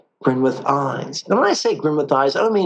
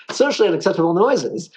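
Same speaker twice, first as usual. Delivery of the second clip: laughing; 7 semitones higher, faster than usual. Real, not acted. Laughing at own joke.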